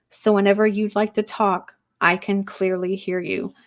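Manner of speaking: measured medium reading voice; channeled spirit message. Acted. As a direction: speak as the departed, gently.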